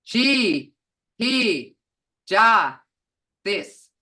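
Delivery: each syllable said apart, loud. Shown as a demonstration. Teacher sounding out a word for the class, slow and syllable by syllable. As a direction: sound it out slowly, one piece at a time. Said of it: "Look here".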